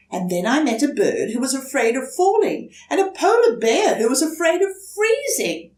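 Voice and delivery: put-on high, fast child's voice; eager turtle. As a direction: rush through the list. Franklin reports, hurrying.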